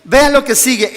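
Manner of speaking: usual speaking voice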